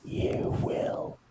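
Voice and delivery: breathy whispered insistence; whispering. Acted. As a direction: whisper it like a secret threat. Whispered repeat of the banter.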